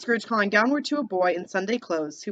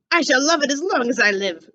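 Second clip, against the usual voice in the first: excited cry; 5 semitones higher, 5 dB louder than usual. Acted. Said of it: "cried Scrooge".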